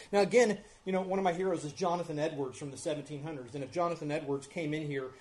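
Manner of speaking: ordinary talk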